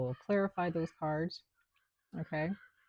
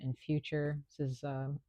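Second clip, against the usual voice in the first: normal talking voice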